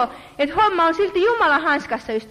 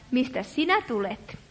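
low, soft mock voice for God; a mock-solemn low murmur; wrong voice for God; 3 semitones lower, 7 dB quieter than usual. Shown as a demonstration. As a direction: drop it low and quiet, the way God did not say it. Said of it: "ei Jumala sano tällä äänellä".

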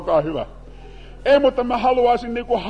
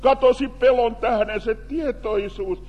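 usual speaking voice

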